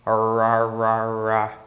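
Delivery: Rá with a strong retroflex R from inland São Paulo; country Paulista R. Shown as a demonstration. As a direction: curl the tongue hard on the R of Rá. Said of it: "aquele R do interior de São Paulo". (Rá com o R caipira do interior de São Paulo)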